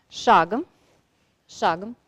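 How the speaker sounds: loud command to a horse; firm command. Real, not acted. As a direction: call the walk out firmly.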